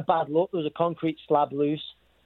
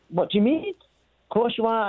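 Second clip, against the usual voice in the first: incredulous, pitched higher; retold disbelief; 8 semitones higher than usual. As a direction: brush off the friend's worry in disbelief. Friend says he can't fight.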